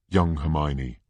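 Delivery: plain reading voice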